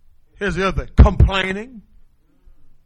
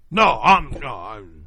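authoritative tone; 9 semitones lower, slower than usual. Shown as a demonstration.

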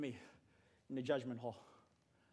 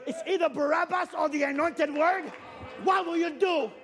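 preaching at full force; 5 semitones higher than usual. Real, not acted. Preacher urging the church to try, full-force preaching. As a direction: drive it home to the congregation.

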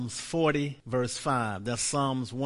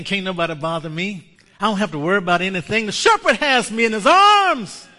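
carefree lamb; 6 semitones higher, 11 dB louder, faster than usual. Acted. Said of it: "He's looking around, saying".

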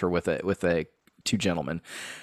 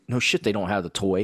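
ordinary delivery

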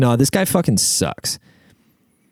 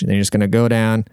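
normal talking voice

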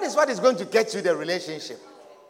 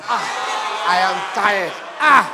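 weary loud complaint; 7 dB louder than usual. Acted. Tired partner complaining acted out.

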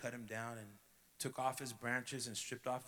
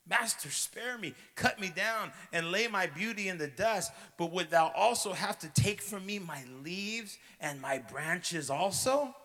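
pleading; 5 semitones higher, 5 dB louder than usual. A narrator's reading. Bamboo pleading to spare branches.

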